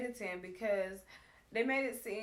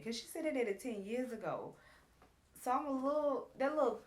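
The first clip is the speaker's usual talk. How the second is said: ordinary delivery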